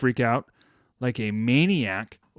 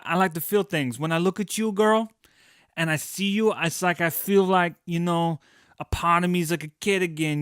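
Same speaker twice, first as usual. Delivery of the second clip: higher, put-on smooth pickup voice; smooth flirty talker; 4 semitones higher than usual.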